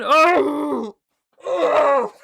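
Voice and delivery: loud high "oh" of awe; long, loud swooning howl; overwhelmed cry. Real, not acted. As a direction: wail it out, overcome with excitement. Overcome recalling seeing actors live.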